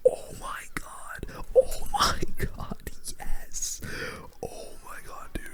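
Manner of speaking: thrilled stage whisper; excited whisper. Real, not acted. Big play wins the round.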